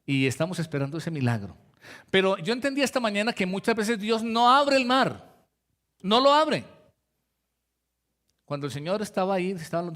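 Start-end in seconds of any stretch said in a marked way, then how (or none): 2.1-6.6 higher, louder insistent preaching; preaching emphasis. Acted. Preacher insisting to congregation, higher louder emphasis. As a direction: drive the point home.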